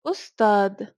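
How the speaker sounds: "أستاذ" ending in a hard d; "أستاذ" closed on a plain d stop. Shown as a demonstration. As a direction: say "أستاذ" as ustad, the th turned to d. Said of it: "pronounce it as a d".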